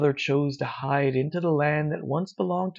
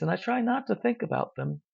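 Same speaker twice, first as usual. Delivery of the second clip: ordinary delivery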